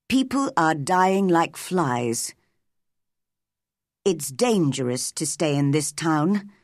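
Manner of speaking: grave warning; measured, serious man's voice. A narrator's reading. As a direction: warn soberly of danger. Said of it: "he answered seriously".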